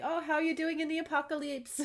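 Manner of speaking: a chirpy celebrity greeting; bubbly TV host impression. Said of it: "Even he was like".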